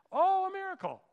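high, loud mock echo; sarcastic skeptic. Skeptic mocking the believer's answer, sarcastic. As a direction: sneer the word back.

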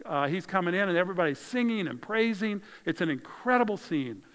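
ordinary talk, nothing marked

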